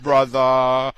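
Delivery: mock Brooklyn street drawl, slowing at the end; hammed-up Brooklyn accent, stretched low final words; Brooklyn homeboy accent. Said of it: "shout out to the homeboys in Brooklyn".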